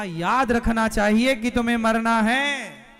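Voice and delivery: loud, hammering sermon line; forceful preaching. Real, not acted. Preacher thundering at the crowd, loud and forceful. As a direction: bring it down hard on है. Preacher driving home the point.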